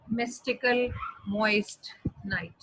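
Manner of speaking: slow, word-by-word "mystical moist night"; spaced-out, deliberate example phrase; alliteration example. Shown as a demonstration. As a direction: draw out each word as a teaching example. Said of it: "This is an example of alliteration".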